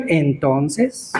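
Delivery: low, rising "well, then?"; pointed retort. Acted. Preacher answering a student's excuse, pointedly questioning.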